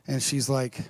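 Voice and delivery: ordinary talk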